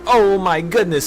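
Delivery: high, gushing mock adoration; put-on starstruck admiration; mock awe. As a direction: gush as if worshipping an idol. Mocks imagined adoring listeners.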